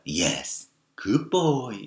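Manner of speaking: put-on tender, encouraging baby-talk lilt; cooing encouragement. Shown as a demonstration. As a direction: coo it gently and slowly. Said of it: "좀 속삭이듯, 그리고 격려하는 목소리 톤으로".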